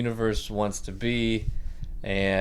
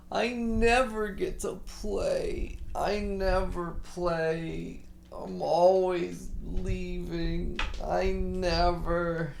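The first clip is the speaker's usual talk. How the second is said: imitated little-boy wail, higher and slow; whiny crying child; 8 semitones higher, much slower than usual. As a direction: stretch it out like a five-year-old whining through tears. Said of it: "He just breaks down".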